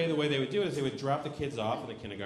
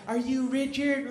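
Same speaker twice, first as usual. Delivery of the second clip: slow, high questioning teacher; teacher asking; 9 semitones higher, 4 dB louder, slower than usual. Retold or imitated. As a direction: ask the child gently but puzzled. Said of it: "She comes over to the clipboard".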